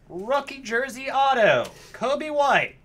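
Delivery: high, loud hype shout; real thrilled announcer-style yell; excited card call. Real, not acted. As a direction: holler the big pull to the room. Calling out a big card pull.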